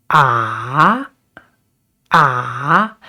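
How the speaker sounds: "ah" sinking low, then climbing; a long, low, scooped "ah"; third tone. Shown as a demonstration. Teacher explaining pitch ranges, down-and-up "ah". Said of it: "So we start with down".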